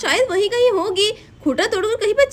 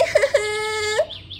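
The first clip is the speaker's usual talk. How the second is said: sob; 2 semitones higher, 4 dB quieter than usual. Acted. Radha weeps over lost cow.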